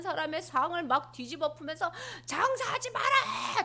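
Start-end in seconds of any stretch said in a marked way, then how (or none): 2.3-3.6 high, slow furious order; angry command. Acted. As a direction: overturn the tables, yelling. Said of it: "예수님이 막 화내셨어요".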